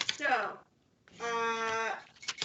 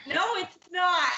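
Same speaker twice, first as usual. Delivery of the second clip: loud, higher panicked cry; panicked shout; 7 semitones higher, 10 dB louder than usual. Real, not acted. Panicked reply to reassurance.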